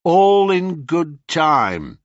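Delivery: slow, deliberate tease; teasing calm. Acted. Perseus teasing the sisters, slow deliberate. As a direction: make them wait. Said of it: "said Perseus".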